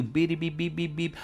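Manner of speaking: high, rapid imitation censor beeps; bleeped-out cursing. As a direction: beep over the swear words by mouth. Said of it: "he cursed. In other words".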